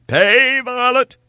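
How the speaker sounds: very high, loud order; commanding cry. A narrator's reading. Stranger to his servant from horseback, loud command. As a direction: bark a command over your shoulder. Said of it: "cried the stranger to his servant".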